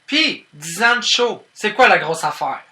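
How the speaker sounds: loud, high-pitched challenge; defiant outburst. Acted. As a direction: throw it out brashly at the audience. Brusque, defiant outburst after silence. (lancé fort, avec défi)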